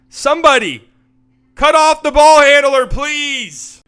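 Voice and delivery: high, very loud plea; exasperated shout. Real, not acted. Commentator begging someone to stop the ball, exasperated shout. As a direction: yell it like a fed-up fan begging the team.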